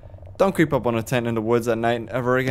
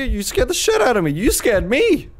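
laughing off fear; 12 semitones higher, 5 dB louder than usual. Acted. Startled hiker shouting, then laughing it off.

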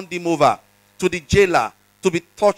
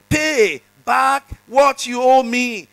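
a raised, slow demand; hammed-up demanding servant, pitched higher; mock demand; 6 semitones higher, 4 dB louder, slower than usual. A narrator's reading. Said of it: "he demanded".

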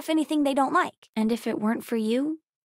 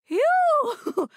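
high, whooped "phew"; big exaggerated sigh of relief; relieved phew; 12 semitones higher, 4 dB louder than usual. Exclaiming in relief.

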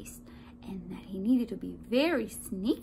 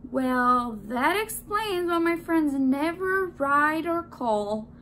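loud, slow, raised indignant voice; angry realization; 5 semitones higher, 6 dB louder, slower than usual. A narrator's reading. Donut realizes his friends were eaten, indignant. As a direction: draw it out loudly, affronted.

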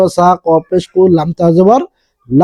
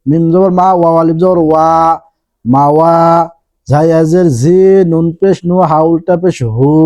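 normal talking voice

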